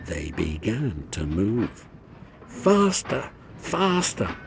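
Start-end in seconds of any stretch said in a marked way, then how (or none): 2.5-4.4 high, grumbling chant of faster. A narrator's reading. Runaway trucks urging each other on, grumbling. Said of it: "they grumbled".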